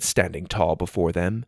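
ordinary delivery, nothing marked

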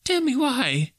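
very high, fearful plea; trembling fear. A narrator's reading. Scrooge asking the fettered ghost, trembling plea. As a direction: beg the phantom for a reason.

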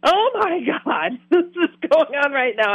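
stunned, barely getting the words out; awed disbelief. Retold or imitated. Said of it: "I am still me going".

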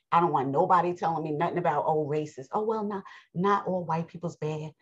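normal talking voice

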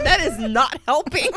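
laughing high protest; trying not to laugh. Real, not acted. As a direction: protest through giggles. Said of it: "I can't stop".

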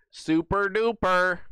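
high, slow put-on voice; silly high voice. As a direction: sing it out like a cartoon. Riffing on the name Susan.

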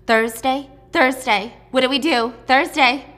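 loud, anxious calls for help; urgent calling. Acted. Sisters call Thursday as armed men try to get in, acted urgent calling.

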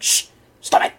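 urgent unvoiced hush; hushing the kids. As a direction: hiss at them to stop, panicked. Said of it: "and I'm like".

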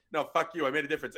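usual speaking voice

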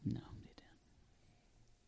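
slow, unvoiced whisper. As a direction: imitate a waitress whispering slowly. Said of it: "that's what my waitress sounded like".

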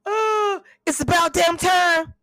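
loud, high, slow cry of joy; triumphant shout. Real, not acted. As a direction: yell it with relief and pride. Triumphant yelling.

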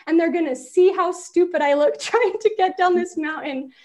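high, loud mock-furious panic; retold panicked yelling. Wife yelling at husband on the ski slope, panicked and furious. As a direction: yell at him, half-sobbing in panic. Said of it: "yelling furiously at my husband".

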